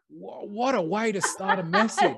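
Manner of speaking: high, slow, laughing disbelief; exasperated and amused, stretched out high; incredulous teasing at a feeble opener; mock dismay.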